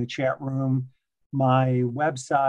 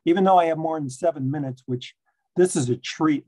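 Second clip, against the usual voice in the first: ordinary delivery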